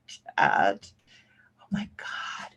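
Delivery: breathy whispered oh my God; whispered dismay. Real, not acted. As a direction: whisper it, exasperated at herself. Lost her chat, exasperated.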